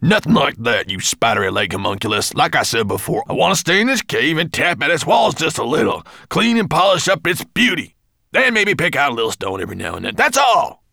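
high, loud, fast indignant dwarf. A narrator's reading. Gravelbeard rebuffing Twigleg, high and loud. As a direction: protest in outraged bursts. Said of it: "cried Gravelbeard indignantly".

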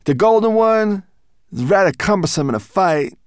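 high, loud, proud creature voice; Berber Chan's voice. Acted. Guide showing off the moonstone walls, high and proud. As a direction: boast about the tunnel walls.

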